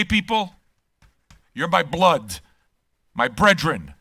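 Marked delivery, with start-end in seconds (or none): none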